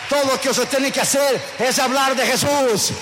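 full-force preaching. Acted. Preacher urging the congregation to speak of Jesus, at full force.

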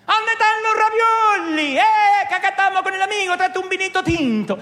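high, loud joyful Italian family call; happy Italian shout. Pretend. Italian calling his family for food and wine, Italian accent. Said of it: "excepto cuando está en familia".

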